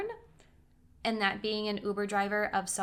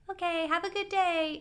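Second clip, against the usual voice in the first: high, sing-out cheery goodbye; overly polite; 9 semitones higher than usual. Retold or imitated. Passenger leaving a creepy driver's car, polite to a fault. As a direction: chirp a too-polite farewell. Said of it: "polite to a fault".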